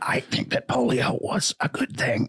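raspy, strangled politician voice. Acted. Senator defending himself on polio, strained raspy R F K voice. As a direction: strain the words through a tight throat. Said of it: "your R F K impression".

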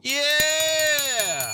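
high, loud celebrating shout; excited yell. Real, not acted. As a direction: cheer the right answer. Host celebrates right answer.

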